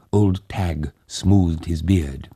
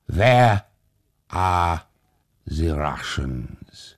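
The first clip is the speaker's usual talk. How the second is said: frowning hussar; much slower than usual. A narrator's reading. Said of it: "the hussar frowned".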